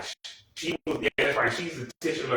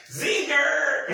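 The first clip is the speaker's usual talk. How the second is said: squealed zinger. Retold or imitated. Cracking herself up, squeals "Zinger!" very high. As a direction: shriek "Zinger!" breaking into laughter. Cheering a comeback in the scene.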